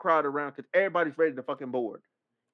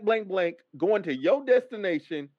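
ordinary talk, nothing marked

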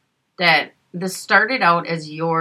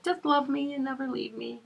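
put-on sweet child voice; 7 semitones higher, 8 dB quieter than usual. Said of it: "He's like".

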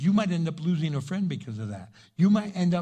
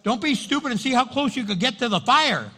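ordinary delivery

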